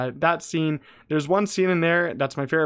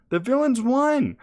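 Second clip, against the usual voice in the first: high, slow, louder cry of disbelief; excited outburst; 10 semitones higher, 4 dB louder, slower than usual. Real, not acted. Vlogger reviewing the finale, exclaiming in excited disbelief. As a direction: throw the words up in amazed delight.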